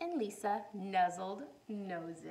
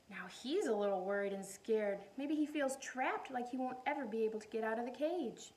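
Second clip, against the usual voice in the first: usual speaking voice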